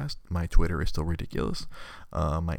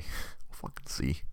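whispered aside; 5 semitones lower, 7 dB quieter, slower than usual. Real, not acted. Repeats phrase, whispered.

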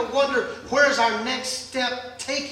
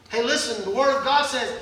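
ordinary talk, nothing marked

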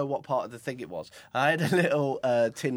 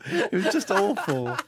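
laughing; 7 semitones higher, 3 dB louder than usual. Real, not acted.

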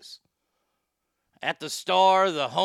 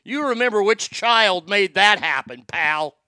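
louder, cocky brag to dad; mock boast; 3 semitones higher, 6 dB louder than usual. Son gloating to his dad, cocky and loud. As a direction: rub it in that you made it happen.

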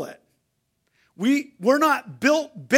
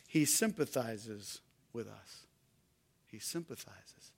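hushed repetition; 11 semitones lower, 15 dB quieter than usual. Acted. Repeated, drops to a hush.

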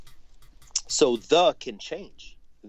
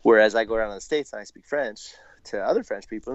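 normal talking voice

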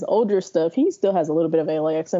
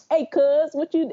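higher-pitched mock LA accent; parody LA accent; 8 semitones higher than usual. Podcaster mimicking how people parody LA talk, parody LA accent. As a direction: imitate people overdoing an LA accent. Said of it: "a parody of that accent".

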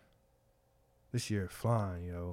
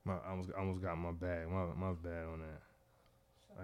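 very soft, low apology; quiet, choked-back feeling; emotional; 9 semitones lower, 15 dB quieter than usual. Real, not acted. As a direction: catch yourself getting emotional, voice dropped right down. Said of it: "I got emotional".

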